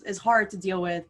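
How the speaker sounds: usual speaking voice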